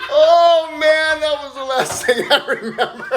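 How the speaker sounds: punchline through laughter, pitched high; laughing. Real, not acted. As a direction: crack up while finishing the joke. Laughing at his own punchline.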